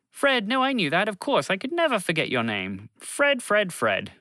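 high, flustered cover-up, tumbling out; Bobby pretends he remembered Fred's name, flustered; hasty lie. A lightly voiced nervous fib. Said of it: "Bobby lied".

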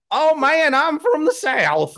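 high, slow, hammed-up Southern drawl; mock a bad actor's fake Southern voice; cartoonish Southern accent. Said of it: "they don't go like".

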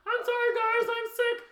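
high whiny sick voice; fake sick voice. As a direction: put on a sick-day whine. Faking sick guard call.

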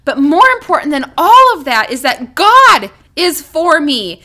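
ordinary delivery

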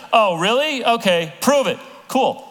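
high, loud mock challenge; skeptical crowd. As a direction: toss back a cocky dare. Crowd voiced responding to Jesus.

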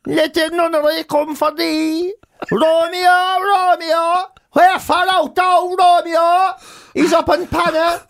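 put-on high, sing-out Cork accent Shakespeare. Touring players doing Romeo in Cork, mock Cork accent. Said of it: "Down to Cork".

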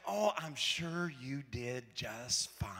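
slow hushed comforting whisper; mom reassuring. Retold or imitated. Mom comforts her nervous child after test, soft slow whisper. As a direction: soothe a worried kid, slowly.

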